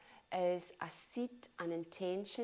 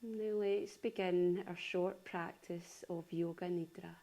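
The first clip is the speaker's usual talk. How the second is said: unhurried yoga nidra voice, long pauses between phrases; calm body-scan delivery, spaced out phrase by phrase; guided meditation. Acted. Leads a guided relaxation.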